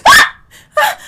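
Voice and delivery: very high, very loud shriek; piercing yelps of fright; startled scream. Real, not acted. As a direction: scream from a sudden jump scare. Jump scare in the game.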